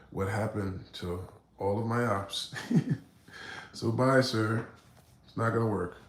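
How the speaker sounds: ordinary talk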